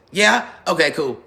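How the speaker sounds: ordinary talk, nothing marked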